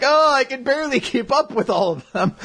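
high, strained mock complaint; struggling fighters. Weakest guild members facing a giant army, high mock strain.

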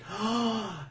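acted intake of breath; shocked gasp. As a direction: imitate a healer's alarmed gasp. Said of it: "she's like".

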